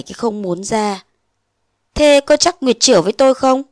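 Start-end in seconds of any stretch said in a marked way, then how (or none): 1.9-3.6 doubting lover, a touch raised; suspicious man. Acted. Phong doubting Nguyệt's pregnancy, suspicious challenge. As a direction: challenge her, half accusing. Phong questions her coldly.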